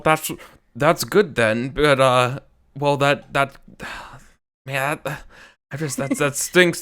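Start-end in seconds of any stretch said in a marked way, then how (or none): none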